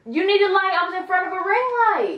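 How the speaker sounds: high, loud indignant repeat; mock outrage. Girlfriend mocking boyfriend's excuse in pretend outrage. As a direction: throw his words back at him.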